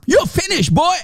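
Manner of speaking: high, mocking taunt; mock Hijab taunt. Mocking the debater, a high sneering taunt. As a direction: sneer it like a bully. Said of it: "he said".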